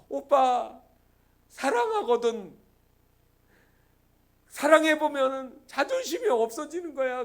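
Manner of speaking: usual speaking voice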